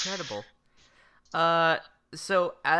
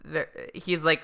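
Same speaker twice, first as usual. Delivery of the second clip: normal talking voice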